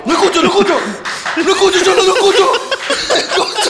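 hammed-up alarm, laughter close by; mock panic. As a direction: cry out like a drunk suddenly gone deaf. Uncle panicking at lost hearing.

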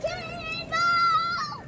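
loud, drawn-out high yell; cannonball yell. Pretend. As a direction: shout it out before jumping. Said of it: "You gotta say it loud before you jump".

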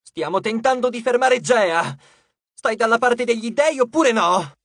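loud, high, urgent challenge; forceful, frustrated demand; shouting. A narrator's reading. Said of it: "gridò Nico".

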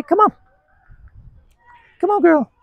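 very high-pitched call to a dog; high, encouraging dog talk; coaxing the dog. Real, not acted.

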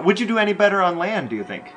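ordinary delivery

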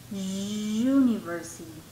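UNIVERSITY slow, with a drawn-out "yu" start; sonido yu inicial. Shown as a demonstration. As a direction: stretch the consonant-like yu of UNIVERSITY. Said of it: "el sonido es con u". (university lento, alargando el yu inicial)

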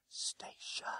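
barely audible voiceless whisper; mouthed, no sound. A narrator's reading. Frightened of being heard, boy mouths it to conductor. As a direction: frame the word with breath alone. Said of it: "lips framed the word".

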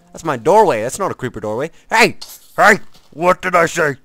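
loud, high-pitched indignant yelling; mock scolding shout. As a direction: yell at an intruder to back off. Yells at creeper at doorway.

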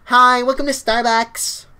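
high, loud, drawn-out greeting; Starbucks voice. Shown as a demonstration. Showing viewers how to say it, Starbucks greeter voice. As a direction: put on a stretched coffee-shop greeter voice. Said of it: "with the Starbucks voice".

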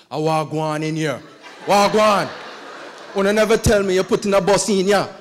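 put-on loud laughing Jamaican accent barging in. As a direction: burst in shouting in a Jamaican accent. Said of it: "rough voice Jamaican guy".

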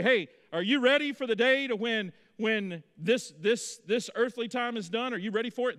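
high, loud hellfire preaching; fiery revival preacher. Retold or imitated. Tent revival preacher warning the crowd, fired up and loud. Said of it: "Those preachers would say".

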